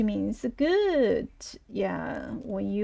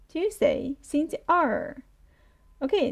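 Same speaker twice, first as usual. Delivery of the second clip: ordinary talk, nothing marked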